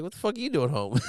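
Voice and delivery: mock kid surprise, half laughing through it; cute little boy. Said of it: "in the cutest little boy way".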